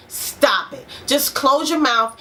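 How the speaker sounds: ordinary talk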